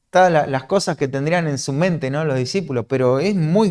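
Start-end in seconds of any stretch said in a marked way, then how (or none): none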